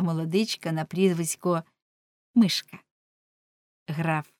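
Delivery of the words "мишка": ordinary delivery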